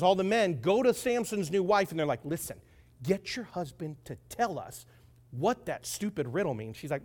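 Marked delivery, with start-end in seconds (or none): 2.2-6.8 low, soft menacing demand; quiet intimidation. Acted. Companions pressuring Samson's new wife, quietly threatening. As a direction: lean on her quietly, voice dropped.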